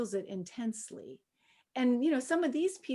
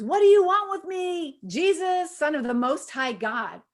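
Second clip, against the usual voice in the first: 높고 큰 소리로 외치는 귀신 흉내; demon shouting; 6 semitones higher, 8 dB louder than usual. Retold or imitated. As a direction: shout it up high and loud like a cornered demon. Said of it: "he shouted at the top of his voice".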